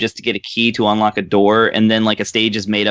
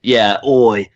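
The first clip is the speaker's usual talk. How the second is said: Cockney oi. Retold or imitated. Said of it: "the deeper voice".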